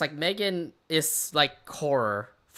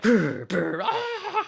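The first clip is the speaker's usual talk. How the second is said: imitated horror movie jump scare; 6 dB louder, much slower than usual. Imitating scary movie speakers.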